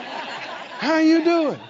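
slow, drawn-out pitiful "How you doing?"; stretched, pitying "How you doing?". Shown as a demonstration. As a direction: ask "How you doing?" like a sad sympathizer. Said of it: "people even ask that in a pitiful voice".

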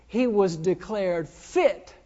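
normal talking voice

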